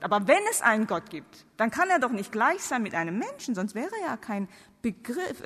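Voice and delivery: usual speaking voice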